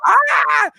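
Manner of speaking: a high mock yelp of fright; retold scream. Retelling the owl on the highway, a high mock scream. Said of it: "literally yelled at the top of my lungs".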